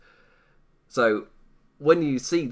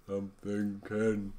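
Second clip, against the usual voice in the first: much softer, very slow yawning drawl; mock yawn; 2 semitones lower, 8 dB quieter, much slower than usual. Streamer imitating the Night at the Museum statue with a slow mock yawn. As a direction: stretch it out through a fake yawn. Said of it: "like I'm yawning".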